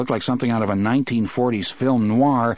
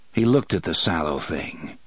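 usual speaking voice

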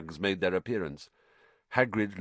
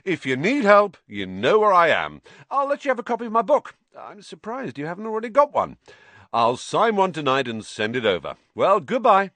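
high, loud, self-important celebrity; breezy boasting, projected for all to hear; Lockhart; 10 semitones higher, 6 dB louder than usual. A narrator's reading.

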